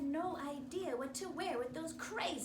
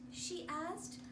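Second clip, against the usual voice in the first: plain reading voice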